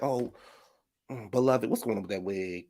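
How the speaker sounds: a hint of a judgy church friend; Ayanna. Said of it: "She'd be like".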